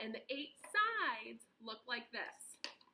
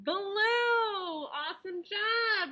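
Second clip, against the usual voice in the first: high excited praise; 7 semitones higher, 6 dB louder, much slower than usual. Real, not acted. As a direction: cheer their answer. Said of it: "praising the child's answer".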